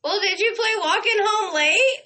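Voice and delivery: high, loud retold call-out; speaking up. Fan calls out to the singer mid-show, high and loud. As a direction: re-enact piping up loudly from the crowd. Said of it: "I speak up".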